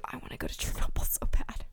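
breathy whispered longing; whispering. Real, not acted. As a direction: whisper it like a secret wish. Longing aside about visiting Chernobyl.

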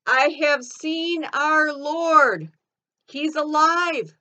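loud, high, slow proclamation; triumphant shout. Acted. Mary runs to town to tell disciples He's alive, triumphant shout. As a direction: shout the joyful news, drawing out each phrase. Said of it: "and I shouted".

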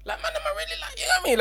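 high squeaky disbelief. Real, not acted.

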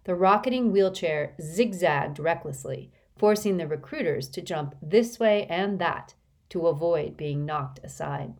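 ordinary delivery

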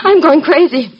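loud, distraught exclamation; frantic outburst. Acted. Distressed woman pleading with Simon Templar, frantic. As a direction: blurt it out in desperation.

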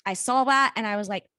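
ordinary talk, nothing marked

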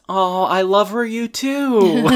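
high, sing-song lovey imitation; mock gushing. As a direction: gush it way up high. [name]'s mock love declaration.

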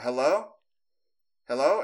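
short rising calls; retold shout. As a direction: call out into the dark, unsure who's there. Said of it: "I, like, shout out".

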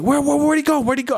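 high, stammering imitation; high-pitched imitation, hurried; mock frantic lady. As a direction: repeat it in her high frantic voice.

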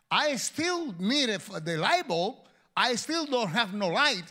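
loud, high preaching climax; full-force pulpit voice. Acted. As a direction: drive it home like a revival preacher. Preacher builds to a shout.

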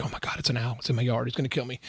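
soft, low-volume pretend alarm; mock hushed panic. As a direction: play scared of it, very quietly. Imagined scare at an owl in the yard.

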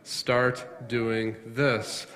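slow, measured parental command; dad calmly telling kids to behave; slow firm dad. Retold or imitated. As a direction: lay down the law calmly. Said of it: "I talk to them and say to them".

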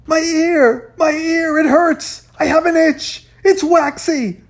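high, loud cries of agony; hysterical wailing. A narrator's reading. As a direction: shriek frantically, begging for help. Feigning deafness in panic.

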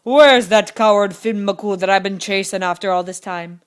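loud, demanding giant; bellowing giant. A narrator's reading. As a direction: bellow it like a giant hunting his rival. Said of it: "bellowed the giant at the door".

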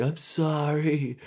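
high, slow wailing surrender; mock sobbing. Caught suspect giving up to police, mock sobbing. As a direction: drag out the words in a hammed-up cry. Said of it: "I'm just sobbing".

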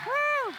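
high cheering whoop; excited woo. Real, not acted. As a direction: cheer like at a concert. Crowd cheer after talk.

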